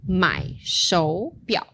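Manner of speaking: 买手表 said slowly, third tones clear; slow model phrase. Shown as a demonstration. Teacher models tone change slowly for student. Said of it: "that third tone remains".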